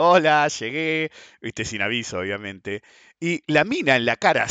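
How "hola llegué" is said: slow, louder breezy hello; imitating a cocky arrival; casual greeting. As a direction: stroll in like you own the place. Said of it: "como diciendo".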